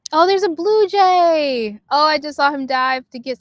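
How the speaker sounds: light up at the sight of the blue jay; delighted bird spotting. Real, not acted.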